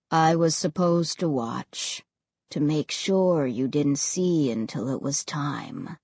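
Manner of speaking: low, heavy ghost voice; quiet regret, low and cold; deep and cold. A narrator's reading. Ray's voice.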